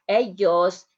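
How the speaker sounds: "ellos" said as "eos", the ll dropped; double L silent. Shown as a demonstration. Teacher showing students the "ellos" with a silent ll. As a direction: glide straight from e to o in "ellos". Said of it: "the pronunciation of the double L".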